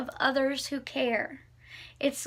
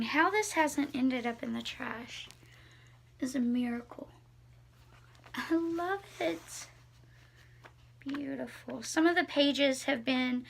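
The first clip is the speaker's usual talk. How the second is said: usual speaking voice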